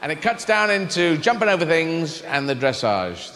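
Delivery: ordinary talk, nothing marked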